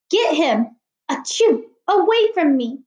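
commanding. Acted. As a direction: bark the order between sneezes. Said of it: "Miss Morales commanded".

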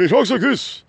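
high, affected imitation; pretentious voice. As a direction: mimic a pretentious talker. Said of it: "really pretentious, really fast".

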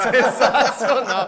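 slow, laughing through the word; cracking up while saying it. Real, not acted. Laughing at the sponsor pyramid joke.

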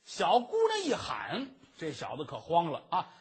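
ordinary talk, nothing marked

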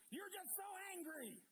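high-pitched mock angry yell. Preacher imitating a man who yells at someone else, angry. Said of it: "angry man that yells at someone else".

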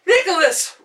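very high, loud call; yell. Acted. Rachel calls to Nicholas while galloping in, yell. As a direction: shout his name across the glade. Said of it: "Rachel yelled".

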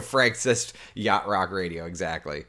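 ordinary talk

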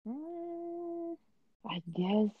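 ordinary talk, nothing marked

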